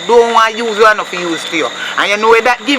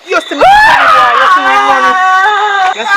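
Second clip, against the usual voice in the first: scolding shout, much higher and louder; angry yelling mother; 12 semitones higher, 7 dB louder than usual. Acted. Mother yelling at her son for playing in dirty water, furious. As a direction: yell at the child in fury.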